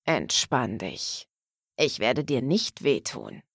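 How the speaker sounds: low, soft, slow soothing voice; soft reassurance. A narrator's reading. Man to tense woman in his arms, soft reassurance.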